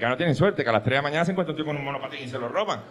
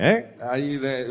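usual speaking voice

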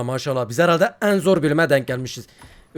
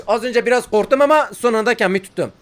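ordinary talk

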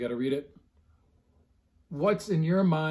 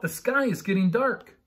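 Ava calling out; 5 semitones higher, 3 dB louder than usual. A narrator's reading. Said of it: "Ava called out".

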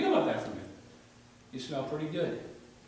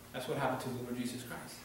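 normal talking voice

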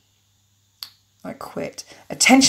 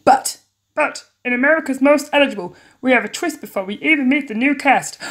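TV host; 5 semitones higher, 4 dB louder than usual. Acted. Host announces a twist.

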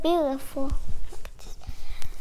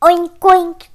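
loud, higher playful oinking; pig oinks; 5 semitones higher, 14 dB louder than usual. Acted. Voicing the pig in a picture book, loud oinks. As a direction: oink it out like a pig. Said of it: "grunted the pig".

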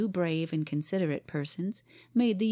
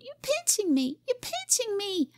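put-on a much higher wailing little girl; child's scream. A narrator's reading. Cora complaining to her father, high child's wail. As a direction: whine it out like a pinched child. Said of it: "she screamed".

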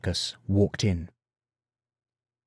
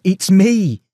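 true voice; 9 semitones higher, 8 dB louder than usual. A narrator's reading. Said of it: "no sign of creaking".

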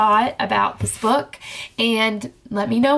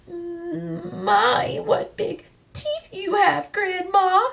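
stuttering; 9 semitones higher, much slower than usual. Acted.

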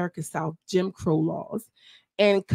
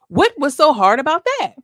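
loud, high exasperated question; 7 semitones higher, 6 dB louder than usual. Real, not acted. Vlogger venting at the team, exasperated. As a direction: throw the question out in disbelief.

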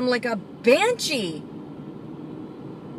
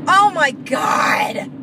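shocked shout; 2 semitones higher, slower than usual. Real, not acted. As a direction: yell it at the reckless driver. Driver cut off.

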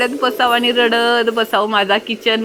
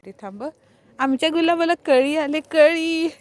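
delighted; 6 semitones higher than usual. Real, not acted. Delighted at a rosebud.

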